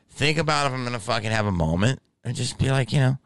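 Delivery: normal talking voice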